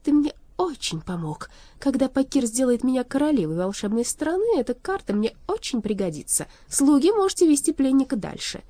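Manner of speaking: high, effusive villainess; gloating young sorceress. Acted. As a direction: gloat sweetly, then give a loud order.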